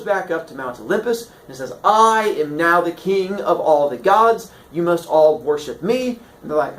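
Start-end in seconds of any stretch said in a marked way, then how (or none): 1.8-6.2 loud, grand declaration; slow, pompous royal announcement; kingly proclamation. Acted. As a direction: declare your rule to all the gods. New king proclaims himself.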